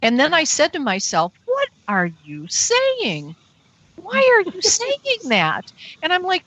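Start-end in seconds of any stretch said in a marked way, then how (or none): 1.4-5.7 slow, stretched disbelief swinging up high; incredulous self-scolding. Retold or imitated. Talking to herself after begging aliens, incredulous. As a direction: question yourself in drawn-out disbelief. Retelling her startled self-questioning.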